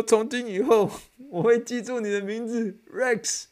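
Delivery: imitating the top pick. Retelling the top pick's promise to remember Rex, in a slow solemn voice. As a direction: intone it like a grand promise. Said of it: "他这么说".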